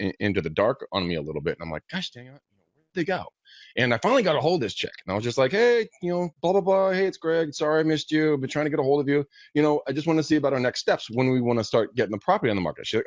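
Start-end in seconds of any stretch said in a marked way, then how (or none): 1.9-3.2 softer, muttered frustration to himself; frustrated mutter. Retold or imitated. Agent stewing over silent clients, muttering softly. Clients went dark on him. 5.5-12.9 bright, higher, friendly call voice; upbeat phone voice. Retold or imitated. Agent calling a client back, upbeat and higher.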